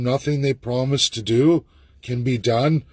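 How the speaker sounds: ordinary delivery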